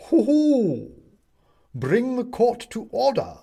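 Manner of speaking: high, loud hoot into a command; outraged owl judge. Acted. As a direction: hoot and call the court to order. Owl judge outraged, rocking fiercely.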